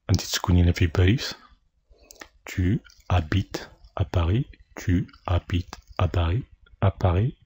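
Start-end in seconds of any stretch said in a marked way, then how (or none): none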